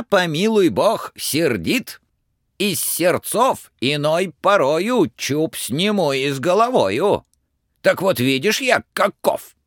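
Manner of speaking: a tsar granting pardon but warning; stern tsar. Acted. Tsar tells Ivan to fetch the firebird, stern. Tsar forgives, then orders.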